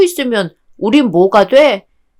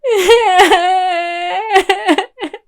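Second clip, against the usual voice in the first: high, long laugh mixed with sobs; long teary laugh; 7 semitones higher than usual. Acted. Girl laughing at friends' joke, tearful laugh. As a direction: release everything in a tearful laugh.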